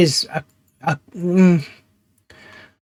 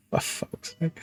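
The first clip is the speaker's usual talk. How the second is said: whispered curse; 16 dB quieter, slower than usual. Real, not acted. Cure rate drops again.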